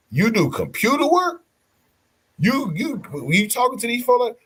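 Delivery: high, incredulous imitation of a surprised woman; hammed-up high voice of a startled onlooker. Retold surprised woman at hotel.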